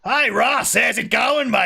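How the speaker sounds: high, loud, cheery Australian greeting; Australian reporter. Retold or imitated. Retelling a TV piece, the Australian news guy hailing Russell in a loud cheery Australian voice. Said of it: "the Australian news guy's like".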